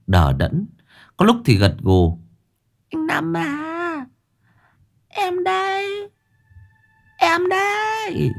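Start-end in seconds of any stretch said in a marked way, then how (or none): 2.9-8.3 very high, slow, eerie drawl; possessed singsong voice. Acted. Possessed Hoàng Anh calling to Lão Năm, eerie slow high voice. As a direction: draw each word out, high and ghostly.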